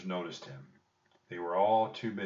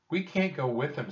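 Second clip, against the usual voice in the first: protesting Jack; 7 semitones higher, 4 dB louder than usual. A narrator's reading. Jack objects to Annie's plan.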